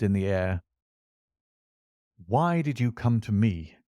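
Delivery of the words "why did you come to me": plain reading voice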